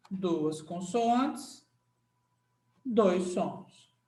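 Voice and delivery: Duas consoantes said very slowly, as if writing it; slow dictation. Shown as a demonstration. Teacher dictating the summary rule, slow. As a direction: drawl it out for note-taking. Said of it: "Você vai botar assim o resumo".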